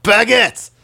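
over-the-top mock French accent, breaking into a laugh. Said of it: "like, a French accent".

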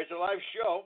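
usual speaking voice

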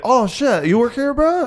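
high, excited bro voice; excited high school kid. Retold or imitated. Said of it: "they'd be like".

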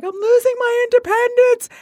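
high, loud, half-laughing mock wail; bawling. Telling a friend about panicking over moving in, a high loud mock wail. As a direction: wail it like a big overreaction. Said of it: "I was bawling".

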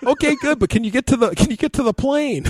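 buddy's voice. Retold or imitated. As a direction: Pete only cares about the plane. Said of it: "And Pete's like".

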